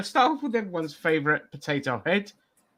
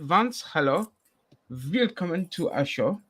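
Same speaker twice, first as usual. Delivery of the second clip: ordinary delivery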